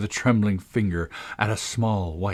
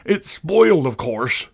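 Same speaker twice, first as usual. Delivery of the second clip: loud, high, furious wail; shrieked dismay, easing at the end; wild distress; 7 semitones higher, 6 dB louder, slower than usual. A narrator's reading. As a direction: burst out in a tantrum. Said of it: "beginning to stamp about wildly and tear his hair".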